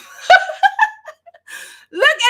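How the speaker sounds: squealing high laugh that nearly cries; high laughing fit. Real, not acted. Mother cracking up at her daughter's notes, helpless high laugh. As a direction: break into a helpless high cackle.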